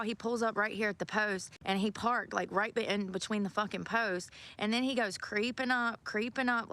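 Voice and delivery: loud, heated venting about the creep; angry rant. Real, not acted. Woman alone in a park venting at a creepy driver, loud and angry. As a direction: blast the creep out loud, fed up.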